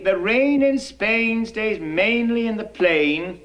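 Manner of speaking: "rain" and "plain" drawn out with pure ay; model pronunciation. Shown as a demonstration. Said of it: "I want you to say".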